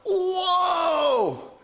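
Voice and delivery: high amazed exclamation; amazed whoa. Retold or imitated. New believer struck by the commandments, amazed whoa. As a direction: let the wonder burst out. Reacts to the discovery.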